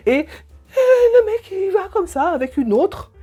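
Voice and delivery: real tears breaking through the words; crying. Real, not acted. Breaks down telling of betrayal. (voix brisée par les larmes)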